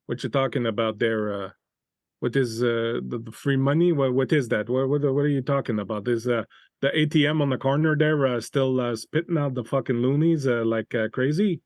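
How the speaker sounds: mock French-Canadian accent, halting with uhs; thick French accent. French-accented stranger quizzing players about free money, thick French accent. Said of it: "he's got this thick French accent".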